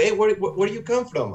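high, lightly voiced nosy asker; mock curious stranger. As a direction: toss it off like a puzzled local. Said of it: "people say, like".

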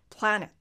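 プラネット said as English planet, stressed wide American 'a'; English pronunciation demo. Shown as a demonstration. Teacher models planet for learners, careful American pronunciation. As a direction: punch the first vowel of プラネット, American style.

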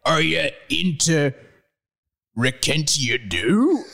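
"Rekentia Do" very slowly, piece by piece; sounding it out. Shown as a demonstration. Reader tries Hagrid's odd spelling aloud, sounding it out. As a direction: puzzle out "Rekentia Do" slowly as spelt. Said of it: "try and pronounce it before I spell it out".

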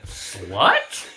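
put-on girl's guess. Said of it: "this girl said".

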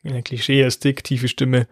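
usual speaking voice

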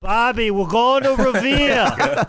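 put-on slow, high, Boston accent like in The Town; high, slow hammed-up Boston accent showing his friend how it's done. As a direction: drawl it out like a Boston tough guy. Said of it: "watch The Town".

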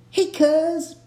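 high-pitched mock greeting to a cousin; playful shout-out. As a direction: call out to your cousin. Calls out to the giraffe's cousin.

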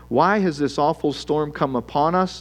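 ordinary talk, nothing marked

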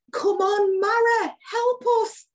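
put-on high, broad Sunderland accent. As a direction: pitch it way up in thick Geordie-Sunderland. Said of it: "in the most Sunderland accent".

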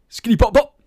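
high, loud gunshot ad-libs; rapper ad-lib noises. Pretend. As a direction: bark out playful drill ad-libs. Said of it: "don't mean you can say all these noises".

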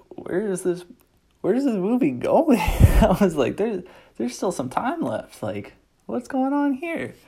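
a fan losing it at the screen, laughing as it builds; incredulous disbelief. Retold or imitated.

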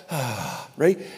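ordinary talk, nothing marked

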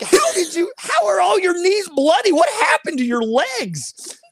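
high, exasperated dad questioning; mock appalled. Playing dad to a friend back with bloody knees, aghast. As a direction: demand answers like an alarmed parent, hammed up.

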